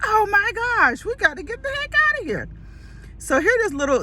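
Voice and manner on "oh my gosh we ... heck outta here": mock alarm. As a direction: retell your panic at being stranded. Retold alarm, stuck in Klan country.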